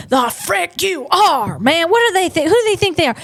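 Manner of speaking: high-pitched, hammed-up indignation; mock outrage. Vlogger defying British tax demands, mock outrage.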